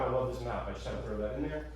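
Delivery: ordinary delivery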